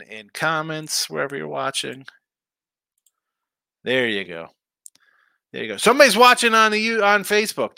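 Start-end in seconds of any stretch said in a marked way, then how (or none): 5.8-7.8 excited. Real, not acted. Spots a Facebook viewer.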